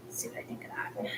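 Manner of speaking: soft whispered aside. Real, not acted. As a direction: murmur it under your breath.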